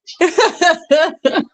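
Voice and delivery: high, tickled laughter; amused laugh. Real, not acted. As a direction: laugh in high little peals. Laughing after playful calling.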